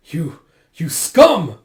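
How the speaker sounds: slow, sputtering start into loud shout; outraged insult. Retold or imitated. Soldier cursing the man cutting them down, outraged shout. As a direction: spit the insult furiously.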